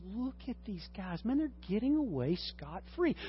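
soft, conspiratorial murmur; hushed aside. Retold or imitated. Psalmist quietly pointing out the wicked to a listener. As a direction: point it out quietly, almost under breath. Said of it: "What he's saying is".

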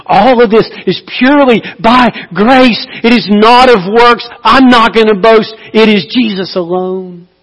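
fervent preacher's declaration; preaching at full force. Acted. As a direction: ring it out from the pulpit. Preaching climax.

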